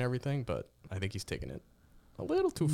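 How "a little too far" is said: sudden high squeaky tail; mock high squeak. Joking about Mac Jones, voice jumps high. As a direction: squeak out the end in mockery.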